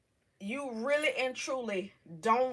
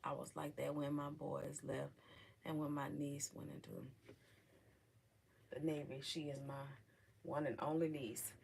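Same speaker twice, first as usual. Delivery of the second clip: quiet, near tears; 7 semitones lower, 15 dB quieter than usual. Real, not acted. Trails off into very quiet speech.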